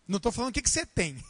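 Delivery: usual speaking voice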